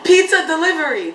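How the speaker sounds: high, loud punchline imitation; Dushin.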